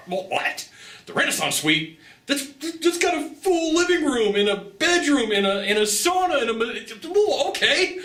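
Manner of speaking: excited disbelief. Retold or imitated. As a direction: gasp at the upgrade in delight. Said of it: "I was all excited".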